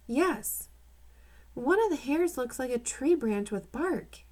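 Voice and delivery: put-on curious boy peering into a microscope, light kid voice; child voice. A narrator's reading. Child voiced in read story.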